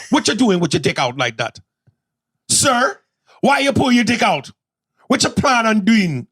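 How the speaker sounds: high, scandalized Jamaican questioning; Jamaican woman. Acted. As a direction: demand answers in a Jamaican accent. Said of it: "ask a Jamaican woman".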